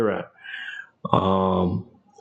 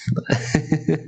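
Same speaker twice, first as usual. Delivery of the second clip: laugh; 6 semitones higher than usual. Real, not acted. Laughs at own joke.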